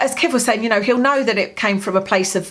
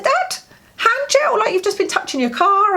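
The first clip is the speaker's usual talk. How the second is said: high pitched exasperated telling-off; alarmed scold; 12 semitones higher than usual. Retold or imitated. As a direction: catch him before he smokes. Alarmed daughter scolding dad.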